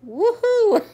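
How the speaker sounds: high, loud, drawn-out woohoo; excited whoop. Real, not acted. Eager reader whooping about the steamy chapters ahead, excited. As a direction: cheer it out, stretching the woohoo.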